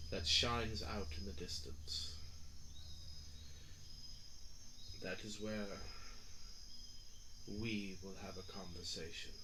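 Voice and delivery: low, quiet, measured god voice; deep mysterious voice. Acted. GM voicing deep entity.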